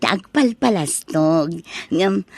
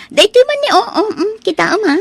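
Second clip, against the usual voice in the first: much higher, eager boasting; showing off; 9 semitones higher than usual. Acted. Wife flaunting her costly watch to the neighbour, excited. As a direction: flaunt it proudly. Bragging about expensive watch.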